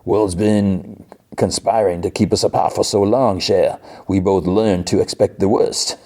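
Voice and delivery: badly faked Cajun accent; Gambit. Gambit woos Rogue, terrible hammy Cajun accent. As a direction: ham up a Cajun lover's speech. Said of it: "the worst Gambit accent ever".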